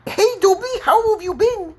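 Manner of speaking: very high squeaky little creature; amateur Dobby impression in falsetto; Dobby imitation. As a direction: squeal it like a small cartoon elf. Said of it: "I can't imitate it".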